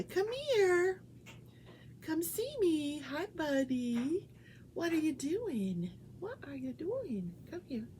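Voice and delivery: high coaxing voice for the pet; lightly high pet talk. Real, not acted. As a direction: coax the dog to come. Coaxing her pet over.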